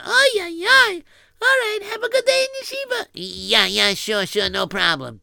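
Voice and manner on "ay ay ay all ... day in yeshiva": much higher sighing Yiddish mother; high-pitched mother sending him off. Acted. Mother voiced again in story.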